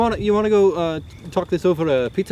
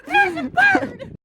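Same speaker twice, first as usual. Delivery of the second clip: very high, drawn-out squeal; hammed-up exclamation; much slower than usual. Show host pointing out a bird, squealed and hammed-up. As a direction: squeal it out slowly for the viewers.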